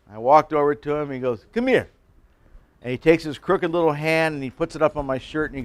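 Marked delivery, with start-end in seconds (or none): 1.5-1.9 higher summons, lightly imitated; mimicking classmate. Classmate beckons the pastor over, lightly imitated. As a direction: beckon him closer. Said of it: "I'm gonna mimic him".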